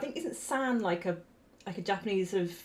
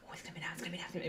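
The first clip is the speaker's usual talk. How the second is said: whispering; 14 dB quieter, much faster than usual. Retold or imitated. Anxious inner thought before proposal.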